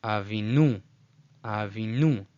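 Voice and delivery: avinu as ah-vee-NOO; wrong final stress. Shown as a demonstration. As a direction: hit the last syllable of avinu. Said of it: "it's not".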